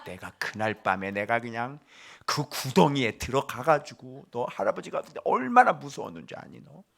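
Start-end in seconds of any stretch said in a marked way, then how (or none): none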